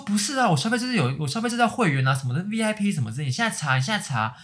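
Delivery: a customer insisting on her VIP membership; angry customer. Retold or imitated. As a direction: push the cashier to check now. Angry customer voiced in story.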